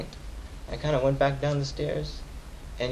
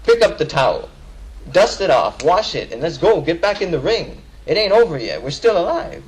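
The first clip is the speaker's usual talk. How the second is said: high, loud rallying sermon climb; preaching at full force; 5 semitones higher, 3 dB louder than usual. Real, not acted. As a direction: rouse the brothers back into the ring. Rising rallying call in the khutbah.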